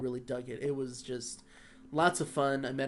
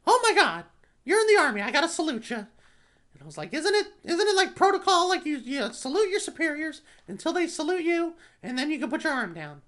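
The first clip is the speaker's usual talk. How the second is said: excited, very high, loud mouse falsetto; squeaky mascot voice at high volume; Mickey Mouse; 13 semitones higher, 8 dB louder than usual. Retold or imitated. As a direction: gush like a starstruck cartoon mouse. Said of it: "Oh, it's Mickey Mouse".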